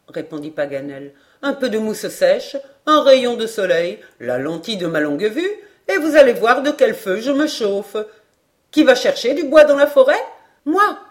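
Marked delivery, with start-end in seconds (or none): 1.4-8.0 confident enthusiast building to a loud finish; spirited, swelling character voice; lively Paganel. A narrator's reading. 8.7-10.2 loud, high, fast rallying question; brisk, high-pitched call to action; calling for volunteers. A narrator's reading. 10.6-11.0 high, loud, eager volunteer; keen shout, pitched way up; crying out. A narrator's reading. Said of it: "s'écria Robert".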